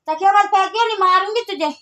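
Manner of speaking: loud, high scold. Real, not acted. Scolding child throwing pillow. (बच्चे को ज़ोर से डाँटना)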